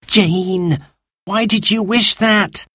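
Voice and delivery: high, drawn-out worried question; dismayed high-pitched child; alarmed. A narrator's reading. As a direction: demand an answer in rising alarm. Alarmed boy challenges sister's wish.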